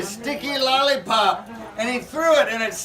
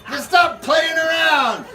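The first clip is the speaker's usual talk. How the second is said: stern shout; 6 semitones higher, 5 dB louder, slower than usual. Acted. Captain scolds chicken.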